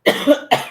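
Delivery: a sudden loud dry cough; sharp cough. Real, not acted. Guest coughing during live interview, sharp loud cough. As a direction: cough hard, caught off guard. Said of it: "Excuse me".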